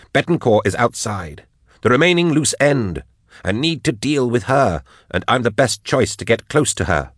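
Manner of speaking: lightly voiced woman pressing her boss; insistent gunwoman. Narrator voices Jaff pleading her case.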